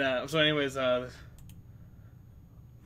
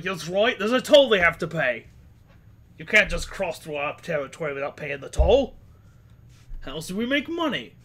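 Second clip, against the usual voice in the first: brigand voice; 3 semitones higher, 7 dB louder than usual. Acted. Brigand demanding toll.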